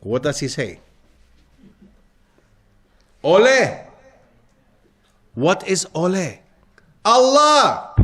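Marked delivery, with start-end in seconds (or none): 3.2-3.8 loud, high Ole shout like at the bullring; bullfighter's cry. Retold or imitated. Teacher to students, acting the bullfighter's call: loud Ole. 7.0-7.9 loud, high Allah, ringing out; emphatic call. Shown as a demonstration. Teacher revealing the origin of ole: loud, high Allah.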